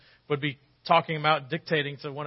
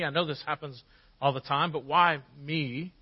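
normal talking voice

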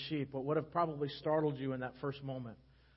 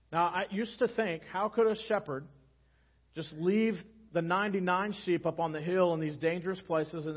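full-force preaching; 3 semitones higher, 6 dB louder than usual. Real, not acted. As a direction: preach it out with rising fervour.

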